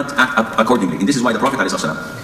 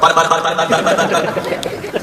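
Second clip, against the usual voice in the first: bursts into open laughter mid-sermon; a quick, real laugh at his own story; 4 semitones higher than usual. Real, not acted. Preacher laughing at a congregant's divorce story.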